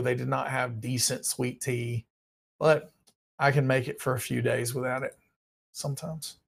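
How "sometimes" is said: ordinary delivery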